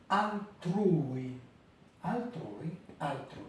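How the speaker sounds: altrui with ui glided into one syllable; Italian model word. Shown as a demonstration. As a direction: repeat altrui, ui together. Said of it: "Les deux voyelles à l'intérieur de la même syllabe".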